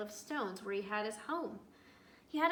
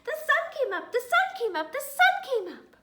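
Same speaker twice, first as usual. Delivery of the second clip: sing-song chirp, again and again; high squeaky triumphant chant; chirping squirrel; 13 dB louder than usual. A narrator's reading. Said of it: "he chirped".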